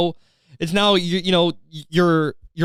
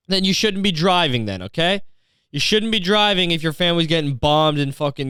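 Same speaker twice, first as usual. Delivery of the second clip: ordinary talk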